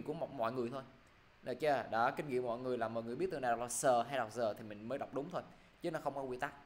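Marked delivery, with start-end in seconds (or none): none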